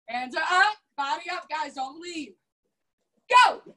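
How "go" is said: higher, louder barked go; loud call. Real, not acted. Coach starting the sparring round, loud barked go. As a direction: fire off the start signal.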